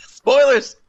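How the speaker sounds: squealed through a laugh, high and loud; laughing outburst at the spoiler; laughing shout. Real, not acted. Laughing shout at friend's spoiler. Teasing about spoiling the movie.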